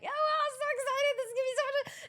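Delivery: very high, gushing stage chatter; put-on excitement. Presenter walking out on stage, exaggerated excitement. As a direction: gush in a squeaky-high mock-excited voice.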